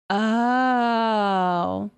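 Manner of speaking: usual speaking voice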